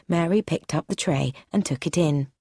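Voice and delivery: ordinary delivery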